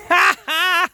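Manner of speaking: loud high cackle; high shouting laugh. Real, not acted. Teepee blown away.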